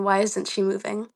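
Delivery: ordinary talk, nothing marked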